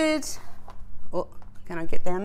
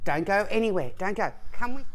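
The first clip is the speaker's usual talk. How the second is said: usual speaking voice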